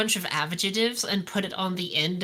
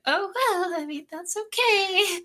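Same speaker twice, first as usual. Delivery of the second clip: much higher, breezy mock shrug; mock unbothered; 11 semitones higher than usual. Mocking a character who shrugs off being spaced, mock unbothered. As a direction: play it like nothing's wrong, way up high. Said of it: "and you'd be like".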